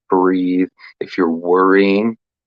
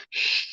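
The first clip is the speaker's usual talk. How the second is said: soft drawn-out shh; shushing; 8 dB quieter than usual. Shown as a demonstration. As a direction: breathe out a quiet shh. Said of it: "you can go".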